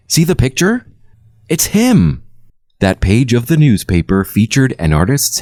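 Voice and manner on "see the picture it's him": much higher, eager exclaiming; excited pointing. A narrator's reading. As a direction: urge her to look, pitch high.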